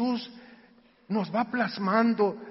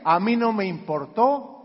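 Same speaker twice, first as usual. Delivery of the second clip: ordinary delivery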